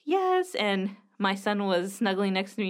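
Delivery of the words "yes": a high, thrilled little yes; excited yes. Retold or imitated. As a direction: squeal a happy yes, much higher. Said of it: "And so I was like".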